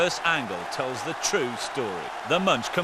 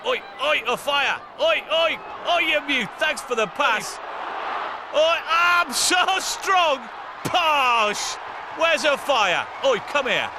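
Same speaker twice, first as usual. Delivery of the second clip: mock player shouts; 9 semitones higher than usual. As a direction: ham up a player bellowing on the pitch. Voicing players on the pitch.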